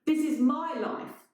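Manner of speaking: high, indignant; lightly voiced angry intruder; indignant newcomer. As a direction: claim it as yours. Newcomer accuses.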